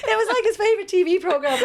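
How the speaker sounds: high, laughing Cork Irish lilt; quoting Rog in Cork accent. Retold or imitated. Retelling Rog's funny excuse to a friend, Cork accent through laughter. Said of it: "he was very off the cuff funny like this".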